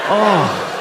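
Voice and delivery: usual speaking voice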